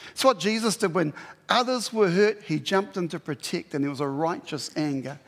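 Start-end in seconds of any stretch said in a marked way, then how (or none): none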